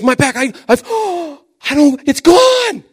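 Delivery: mock the healed grandma's astonished joy; overwhelmed amazement. Grandma overwhelmed as her back pain vanishes, gasping. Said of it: "She starts crying".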